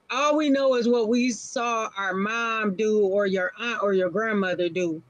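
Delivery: ordinary delivery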